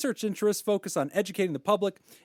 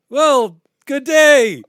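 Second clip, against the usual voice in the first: loud, high-pitched fake Aussie greeting; attempted Australian accent; 8 semitones higher, 13 dB louder, much slower than usual. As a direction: belt out a badly faked Australian accent. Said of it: "is that the right one?".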